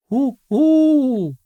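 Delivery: high, loud cooing pigeon. Acted. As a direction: coo like a pigeon. Pigeon coos in story.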